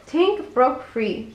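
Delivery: ordinary delivery, nothing marked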